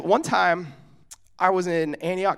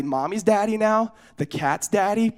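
ordinary delivery

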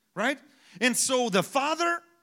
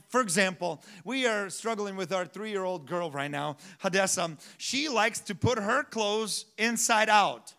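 usual speaking voice

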